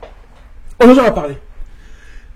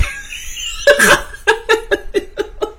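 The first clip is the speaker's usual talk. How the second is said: breathy laugh; 12 semitones higher, 3 dB quieter than usual. Real, not acted. Rire après coup de sac.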